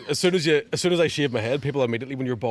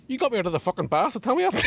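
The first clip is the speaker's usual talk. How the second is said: higher-pitched indignant punchline; mock exasperation; 9 semitones higher than usual. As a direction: play an exasperated man, laugh creeping in. Punchline of joke, annoyed Mini driver.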